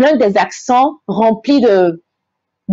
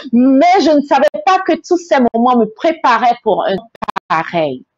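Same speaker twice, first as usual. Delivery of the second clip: usual speaking voice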